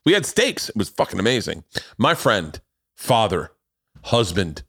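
high, hyped host introduction; announcer intro. Acted. Host introducing his guest to listeners, announcer hype. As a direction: build up the guest like a ring announcer.

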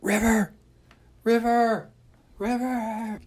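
very high, thin call from far away; faint distant call. Retold or imitated. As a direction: call the dog's name from down the street. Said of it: "I can hear this faint".

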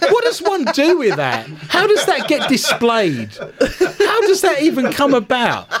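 high-pitched, laughing incredulity; amused bafflement. Real, not acted. Host puzzling over a silly birthday gift, amused bafflement.

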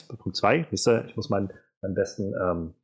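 ordinary delivery

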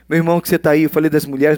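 ordinary talk

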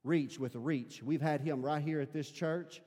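ordinary talk

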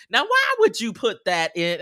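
high, loud challenge; family's mock objection. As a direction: question it in scandalised pretend protest. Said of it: "hear them be like".

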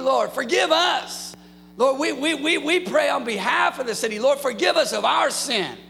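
high, loud pleading prayer to the Lord; fervent prayer. Acted. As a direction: cry out to God for the city. Prays aloud for the city.

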